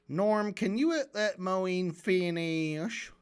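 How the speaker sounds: very slow, stretched southern drawl. Retold or imitated. Host mimicking moderator asking Norm to let someone finish, southern drawl. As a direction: draw the words out in a mock southern accent. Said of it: "I wish Lex Friedman had a southern drawl".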